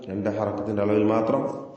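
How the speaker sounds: normal talking voice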